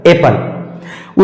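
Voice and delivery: "एप्पल" whispered slowly with a single soft p; correct pronunciation. Shown as a demonstration. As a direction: breathe out "एप्पल" slowly, one p only. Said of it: "इसका सही उच्चारण हो जाएगा एप्पल". ("एप्पल" धीमे फुसफुसाकर, एक ही प के साथ)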